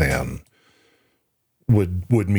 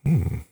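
thoughtful hum; 3 semitones higher than usual. Real, not acted. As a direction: hum as if weighing it. Pondering the pendant question.

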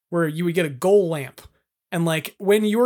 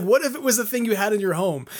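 ordinary talk, nothing marked

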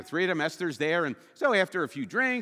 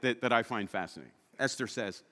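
usual speaking voice

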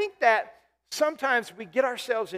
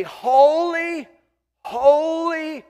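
high, ringing cry of holy; exalted loud holy; loud angelic proclaiming; 6 semitones higher, 6 dB louder, much slower than usual. Retold or imitated. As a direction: call it out like the angels round the throne. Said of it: "It says the angels say".

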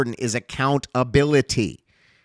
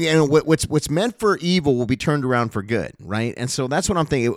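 ordinary talk

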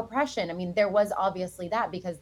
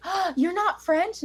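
surprised fan; 11 semitones higher, slower than usual. Retold or imitated. Audience member surprised after show.

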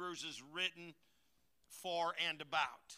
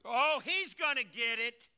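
loud, slow gloating threat; he's gonna get it, slow and loud with a gloat; mock vengeful gloat; 5 semitones higher, 11 dB louder, slower than usual. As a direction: relish the payback, slowly and loudly. Preacher acting out vengeful thought.

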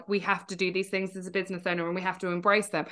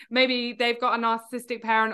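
usual speaking voice

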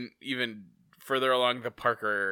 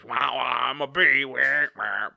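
a light, silly buzzing bee voice; 6 dB louder than usual. Pretend. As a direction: play a wobbly cartoon bee. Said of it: "My impression of a wobbly bee".

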